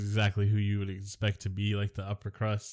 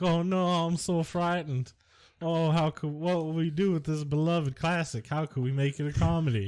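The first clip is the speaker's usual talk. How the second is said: mock frightened Richard Donner; 7 semitones higher than usual. Podcast host mocks a nervous director, high and fretful. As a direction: play the director wringing his hands over a beloved classic. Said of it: "Richard Donner sounds like Jimmy Carter".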